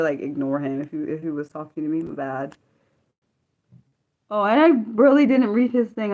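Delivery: ordinary talk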